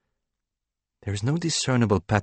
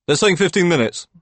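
higher, louder trainee voice; Kevin's voice; 11 semitones higher, 5 dB louder than usual. A narrator's reading. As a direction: Kevin relaying the wait time, in a higher voice. Kevin voiced in read story.

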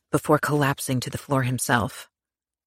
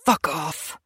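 choked; 3 semitones higher than usual. Acted. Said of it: "Girasol choked".

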